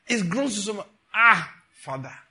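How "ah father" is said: anguished prayer. Acted. Crying out to God in prayer.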